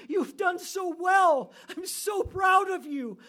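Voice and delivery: very high, drawn-out jubilant shout; proud parent's cry. Acted. Parent embracing a child who did well, shouting with pride.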